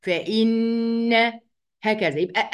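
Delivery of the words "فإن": "فإن" with a very long humming doubled n; doubled noon ghunnah. Shown as a demonstration. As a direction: press on the n of "فإن" and hold the nasal hum. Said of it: "بتك على النون كده وبجيب غنة".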